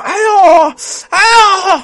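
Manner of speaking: high, loud drawn-out groan of pain. Acted. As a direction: cry out aiyo in agony. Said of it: "疼得正叫唤呢". (疼得大声呻吟哎呦)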